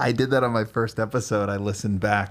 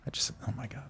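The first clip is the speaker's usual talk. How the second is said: whispered, exasperated aside; flustered whisper; 2 semitones higher, 12 dB quieter, faster than usual. Real, not acted. Flustered, gives up on question.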